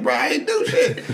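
high, slow, laughing denial; hammed-up innocence through laughter; mock innocent protest. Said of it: "I was like".

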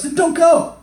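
high, loud burst; exasperated retort. Acted. Answering someone who hates their job, exasperated shout. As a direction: fire it back at a whiner.

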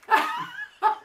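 short high burst of laughter; real high-pitched laugh. Real, not acted. Laughs after forgetting the title.

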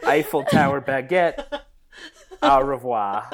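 hammed-up French, laughing through it; mock French. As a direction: play a giggly fake Frenchman. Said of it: "the French will make its way into the episode".

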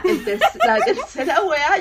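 laughing through the words. Real, not acted. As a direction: giggle it out remembering. Laughing while recounting workout. (riéndose al contar el ejercicio)

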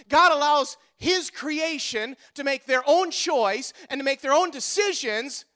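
ordinary talk, nothing marked

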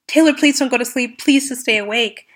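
urgent, fast pleading call; paramedics yelling. Retold or imitated. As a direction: paramedic begging her to stay awake. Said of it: "yell at me and say".

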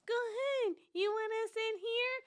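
high drawn-out mock politeness; imitating friend's offer. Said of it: "That's how I sounded?".

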